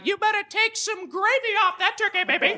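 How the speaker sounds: very high sing-song drawled scolding; sassy mom. Pretend. Mother scolding cocky son, high sing-song sass. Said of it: "You think the price is too high".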